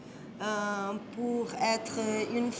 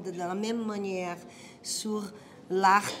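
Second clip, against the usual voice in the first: ordinary delivery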